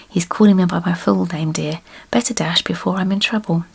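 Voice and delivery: usual speaking voice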